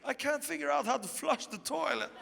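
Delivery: imitating a tearful high voice; tearful complaint. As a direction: whimper it as the upset girlfriend. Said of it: "And she's still crying".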